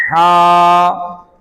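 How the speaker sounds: Quranic recitation. Shown as a demonstration. Teacher demonstrating correct letter recitation, recited. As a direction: intone it like a reciter. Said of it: "ये हकीकत में क्या है".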